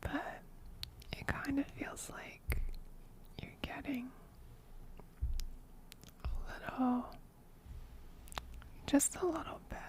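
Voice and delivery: soft ASMR whisper close to the mic; ear whispering. Real, not acted. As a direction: breathe it right into their ear. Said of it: "somebody else redeemed whispering".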